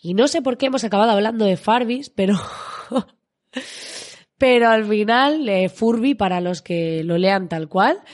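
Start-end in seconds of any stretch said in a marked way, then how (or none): 3.5-4.0 short laugh. Real, not acted. Laughs about Furby tangent.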